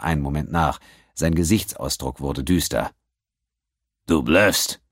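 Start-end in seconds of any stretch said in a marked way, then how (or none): 4.1-4.8 growl. A narrator's reading. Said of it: "knurrte er dann".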